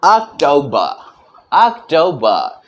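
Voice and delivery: "अक्टूबर" slowly as ak-tau-ba, short u, no r; British October. Shown as a demonstration. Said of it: "दीर्घ उकार की मात्रा का प्रयोग हम नहीं करेंगे".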